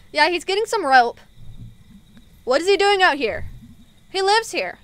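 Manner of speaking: high, shouted conversation; calling out. Acted. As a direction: project it up the well. Characters shout up and down well.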